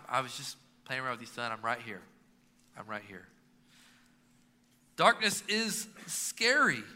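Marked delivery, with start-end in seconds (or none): 0.1-3.3 soothing dad. Retold or imitated. Dad soothing his scared son.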